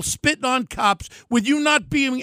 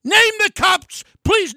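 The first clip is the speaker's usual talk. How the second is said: high, loud barked demand; angry shout; 8 semitones higher, 4 dB louder, slower than usual. Real, not acted. Challenging the governor over the commercials, angry shout.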